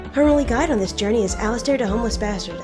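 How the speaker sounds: normal talking voice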